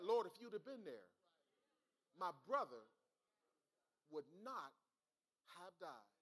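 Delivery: Martha's plea, not indignant, gradually dying away; restrained grief, retold. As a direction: retell a measured plea, phrase by phrase. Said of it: "No, no. Now, she said".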